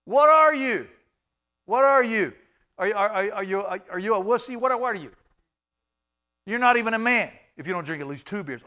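loud, high mocking taunt; taunting bar friend. Bar friend taunting you for leaving, loud mock jeer. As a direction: goad him into a second beer. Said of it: "had a friend say".

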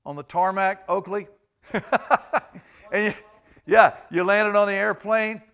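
normal talking voice

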